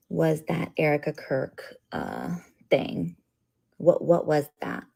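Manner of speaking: ordinary talk, nothing marked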